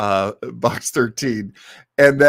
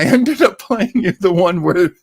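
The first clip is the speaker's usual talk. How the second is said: ordinary talk, nothing marked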